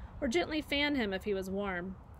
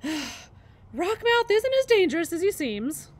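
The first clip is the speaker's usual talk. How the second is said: slow, panting goose voice, higher and louder; breathless old goose; 7 semitones higher, 9 dB louder, slower than usual. A narrator's reading. Goose reassures the robot after the fight, out of breath. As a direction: catch your breath between words as the old goose. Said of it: "said the goose out of breath".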